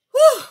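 breathless high laugh; high laugh and whew. Acted. Host laughing after dancing.

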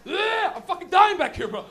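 loud, high wail; anguished complaint. Acted. Non-smoker in the back seat forced to smoke, loud anguished complaint. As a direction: cry out in mock agony.

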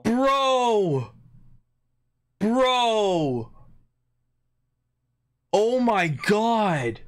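high, stunned exclamations; shocked disbelief. Real, not acted. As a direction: cry out in disbelief. Shocking roll in game.

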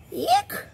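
high, squeaky frightened eek; a tiny squeal of fright. Acted. The bunny chased by the farmer squeals a high little eek.

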